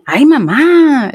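put-on high, slow whine in a rancherita accent; imitated northern Mexican ranch-girl lilt; daughter's rancherita voice. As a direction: mimic a child's drawn-out rancherita singsong. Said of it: "she'll do her little rancherita voice".

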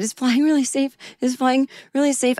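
put-on a lightly childish high question, asked twice; childlike. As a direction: ask it like a scared little kid. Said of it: "I was acting like, ... a child maybe?".

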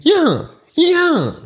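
high cartoon dog voice; Samson impression. Podcaster shows off his Samson voice, high and yappy. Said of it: "ik kan Samson".

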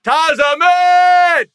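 high, loud drawn-out name; announcer shout. Acted. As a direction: belt the guest's name like an MC. Hype introduction of the guest.

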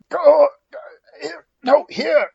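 high, loud coaxing call to a pet; excited calling. Acted. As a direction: call the cat over, bright and eager. Calling the cat over.

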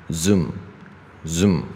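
"Zoom" finished with lips shut on the M; closed-lip M ending. Shown as a demonstration. Teacher modelling an M ending, closed-lip "Zoom". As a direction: say "Zoom", close the mouth on the final M. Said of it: "M结尾是闭嘴".

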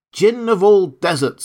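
high, loud, eager appeal; a horse hailing the djinn; horse calling out. Acted.

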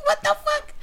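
high shrieking what the fuck; shocked shout. Retold or imitated. Friend reacting to the English-accented Mexican girl, shrieking. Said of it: "I was like".

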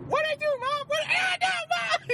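put-on high, loud squealing kid; mock bratty boy shrieking at his mom; whiny kid shout. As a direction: yelp it like a kid getting a spanking. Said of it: "And then you hear".

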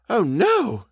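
high, emphatic refusal; decided. A narrator's reading. Housekeeper objects to showing the letter, high and decided. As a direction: refuse firmly, pitched well up. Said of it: "said Missus Gross with decision".

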